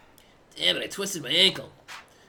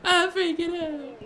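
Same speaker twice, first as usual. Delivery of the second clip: very high, slow, stretched-out mocking squeal; mock freak-out; 4 dB louder, much slower than usual. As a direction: parody the panicked girl, drawing every word out. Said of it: "repeats line, mocking it".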